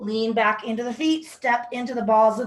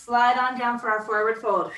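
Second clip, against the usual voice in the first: normal talking voice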